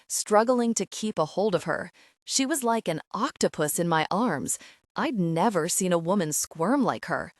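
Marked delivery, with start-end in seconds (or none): none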